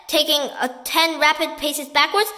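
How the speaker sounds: ordinary delivery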